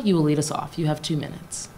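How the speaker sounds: normal talking voice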